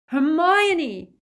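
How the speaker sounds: loud, high, anguished yell; bellowing. A narrator's reading. Boy calls to tortured friend above, desperate bellow. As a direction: bellow the name upward through the ceiling. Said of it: "Ron bellowed".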